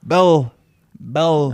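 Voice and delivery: loud mock tourist calling a waiter; Brit abroad. Tourist trying to be understood by a waiter, loud and insistent. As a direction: call it out in single, insistent words. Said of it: "British folk try to communicate".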